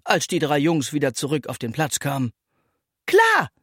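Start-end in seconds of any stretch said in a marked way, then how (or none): 3.1-3.5 a bright, much higher, louder yes; eager call. A narrator's reading. Hanna to the returning boys, eager call. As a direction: answer with delight. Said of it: "rief Hanna".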